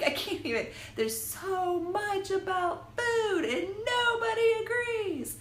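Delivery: high mock wailing. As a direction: wail it in comic despair. Mock despair about diets.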